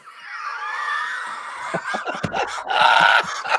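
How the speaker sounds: helpless long laugh, mostly breath. Real, not acted. Laughing at player's name Fashion.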